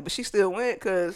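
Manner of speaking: ordinary talk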